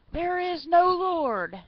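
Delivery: loud, high, slow shout of denial; yelled back. A narrator's reading. Irritated atheist to praying neighbour, loud acted yell. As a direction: shout it across, stretching the words. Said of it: "and yelled back".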